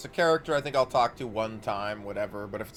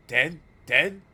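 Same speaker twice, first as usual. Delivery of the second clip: higher, louder ghoulish yelps; eager ghoul voice; 6 semitones higher, 6 dB louder than usual. Acted. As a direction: snap up the word like a starving ghoul. Beggar eager, hungry.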